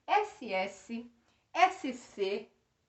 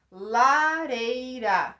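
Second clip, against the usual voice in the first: La-rei-ra said slowly, syllable by syllable; split into syllables; 7 dB louder, much slower than usual. Shown as a demonstration. Teacher counting syllables aloud for the class, slow syllables. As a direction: tap out Lareira one syllable at a time. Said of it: "três sílabas".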